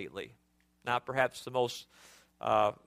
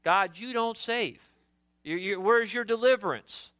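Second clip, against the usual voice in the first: higher, indignant accusation; mock indignant prophet; accusing; 4 semitones higher than usual. Shown as a demonstration. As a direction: demand an answer from God. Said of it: "pointing the finger here".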